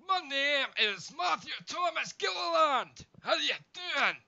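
loud, slow, high Scottish brogue; Scottish accent. Shown as a demonstration. Scottish accent, introducing himself to friend. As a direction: boom out your name in Scottish. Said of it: "say it like a Scotsman".

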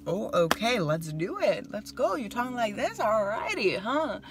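high, sassy ready-to-fight taunt; mock tough challenge; sassy confrontational. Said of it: "my emotions sometimes go brrrr".

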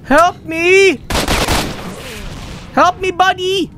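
high, panicked yell for help. Real, not acted. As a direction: shout for help at the top of your voice. Player yelling for help in game.

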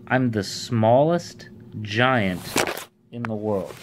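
slow, spaced-out repetition; deliberate repeat. Shown as a demonstration. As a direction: repeat it slowly for the listeners. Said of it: "what did he just say?".

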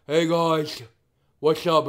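lisping character voice; Francis voice with lisp. Shown as a demonstration. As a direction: do the lisped greeting. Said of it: "the way I did the lisp".

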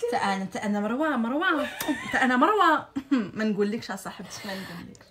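imitating mom on phone. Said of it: "imiter ma mère et leur mère quand elles s'appellent".

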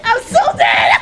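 loud, high shout breaking into laughter; panicked yelling. Real, not acted. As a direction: yell as if doomed, half laughing. Caught in game.

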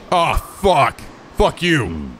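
ordinary talk, nothing marked

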